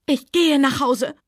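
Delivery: high, slow shriek with the voice breaking; voice cracking, shrieking. Acted. Scarlett shrieking at Rhett, voice breaking. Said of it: "Ihre Stimme überschlug sich und sie kreischte".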